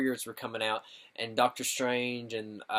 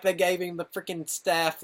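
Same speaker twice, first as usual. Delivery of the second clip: usual speaking voice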